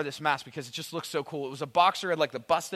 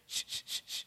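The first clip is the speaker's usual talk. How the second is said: hushed, voiceless "shh" bursts; imitated night noise; 13 dB quieter than usual. Waking parent hears noises in the dark, soft hissing. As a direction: imitate soft repeated shuffling hisses. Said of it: "This is what I hear".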